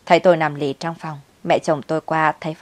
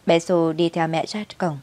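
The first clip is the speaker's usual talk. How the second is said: plain reading voice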